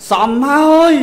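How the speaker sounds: angry scolding. Retold or imitated. Parent scolding a son who drinks all day, loud angry retort. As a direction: bark "សមហើយ" like a fed-up parent.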